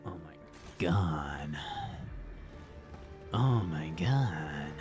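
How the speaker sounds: slow awed whisper. Real, not acted. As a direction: breathe it out in awe.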